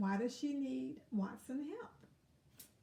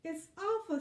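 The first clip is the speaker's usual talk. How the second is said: high, louder ladybug lament; mild sniffling sadness; 7 semitones higher, 5 dB louder than usual. Acted. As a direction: sniff it out, a little upset. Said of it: "sniffed Lola".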